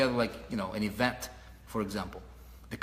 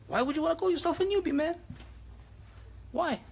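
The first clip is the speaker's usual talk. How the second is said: much higher, fast disbelief; incredulous question. Real, not acted. Coach challenging the audience on calling themselves newbies, high and incredulous. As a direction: ask like it's absurd.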